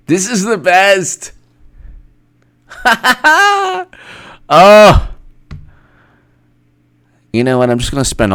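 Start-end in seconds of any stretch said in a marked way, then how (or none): 2.8-5.1 high loud laugh; gleeful high laugh. Real, not acted. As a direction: burst out laughing. Laughing burst.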